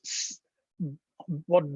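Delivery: ordinary delivery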